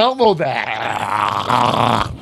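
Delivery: put-on slow, low phlegmy old-man voice; mensch on a bench voice. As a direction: drag it out like a little rabbi doll. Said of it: "You sound like the mensch on a bench".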